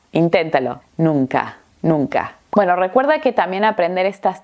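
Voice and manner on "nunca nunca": NUNCA with its second N softened to "nung-ka"; N adouci. Shown as a demonstration. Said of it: "el sonido es más nasal".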